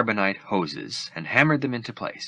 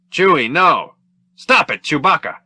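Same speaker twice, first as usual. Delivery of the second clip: higher, loud commanding shout; Han shouting; 8 semitones higher, 8 dB louder than usual. Acted. Han shouts over the fray to stop Chewbacca, loud and high. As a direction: shout him off the fight. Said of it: "Han shouted".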